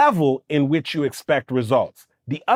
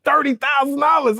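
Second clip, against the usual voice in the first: a laughing near-shout, much higher; shocked laugh; 11 semitones higher than usual. Retold or imitated. Recalling the price tag, a laughing higher shout. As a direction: burst out in disbelief at the price.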